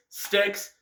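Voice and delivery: normal talking voice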